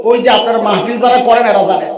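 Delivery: ordinary delivery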